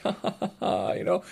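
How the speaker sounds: ordinary talk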